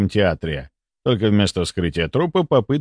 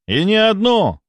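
high-pitched shout after a runner; calling after someone; 10 semitones higher, 4 dB louder than usual. A narrator's reading. The narrator shouts instructions after the running doctor, calling out. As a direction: call out down the corridor. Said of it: "крикнул я вслед".

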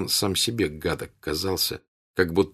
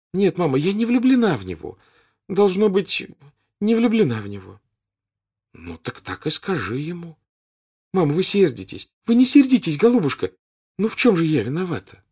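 Natasha pleading; 10 semitones higher, 4 dB louder than usual. A narrator's reading.

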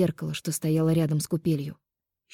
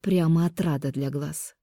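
ordinary delivery, nothing marked

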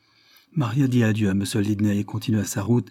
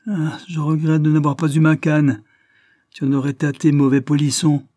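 raised, loud telling-off; angry scolding; 5 semitones higher, 6 dB louder than usual. A narrator's reading. Lidney threatens the boy with a caning, angry scolding.

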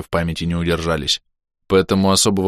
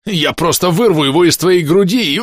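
gleeful threat; 9 semitones higher, 6 dB louder than usual. Acted.

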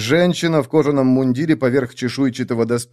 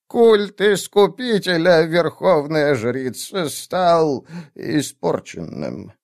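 put-on slow, hesitant old woman; old witch voice; 6 semitones higher, much slower than usual. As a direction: weigh each word like a nervous old witch. Witch character reports news.

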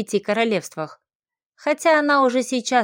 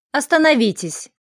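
urgent shouted command; 4 semitones higher, 3 dB louder than usual. A narrator's reading. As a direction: call out to halt them, raised voice. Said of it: "закричал Деголин". (громкий крик)

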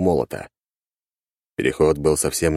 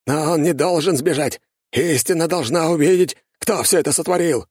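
urgent shout; 11 semitones higher than usual. A narrator's reading. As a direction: call it out urgently. Темус orders capture, urgent.

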